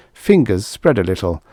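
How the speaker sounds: plain reading voice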